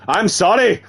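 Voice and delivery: high Irish woman's voice; wobbly put-on Irish lilt; accidental Irish accent. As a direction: play her Irish, pitched well up. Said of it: "Apparently she's Irish now".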